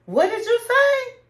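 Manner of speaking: can't believe his ears; stunned disbelief. Retold or imitated. Husband hearing his wife's ultimatum, stunned disbelief. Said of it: "looking really surprised".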